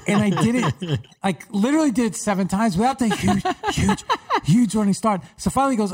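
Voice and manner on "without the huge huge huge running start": stumbling retries on "huge" with a puffed H; higher-pitched, halting attempts at the H of "huge"; failed H in huge. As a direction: repeat "huge", struggling to push out the H. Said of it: "I'm whispering because that's the only way".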